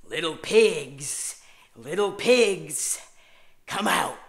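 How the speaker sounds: slow, loud, menacing drawn-out call; creepy Jack Torrance. Retold or imitated. Babysitter playing the Shining axe killer at the bathroom door. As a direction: taunt them slowly like a stalking killer. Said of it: "and I would go".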